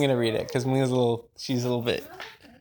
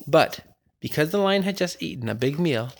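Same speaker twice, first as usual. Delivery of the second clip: plain reading voice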